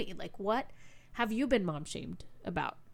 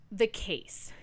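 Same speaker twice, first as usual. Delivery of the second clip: ordinary talk, nothing marked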